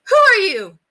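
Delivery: very high, loud demand; alarmed cry. A narrator's reading. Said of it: "she cried".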